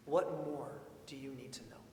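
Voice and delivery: ordinary delivery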